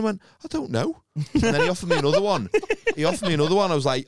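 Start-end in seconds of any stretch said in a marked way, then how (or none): 0.4-1.0 high-pitched mock protest; high, put-on bewilderment; retold clueless answer. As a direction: squeak it out as if clueless. Retold bewildered answer to his missus.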